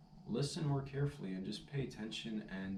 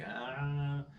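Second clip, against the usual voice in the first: higher, louder ah of giving in; conceding ah; 5 semitones higher, 5 dB louder than usual. Retold or imitated. Stranger finally convinced by the tag, mock conceding ah. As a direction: let the stranger finally give in. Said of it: "He's like".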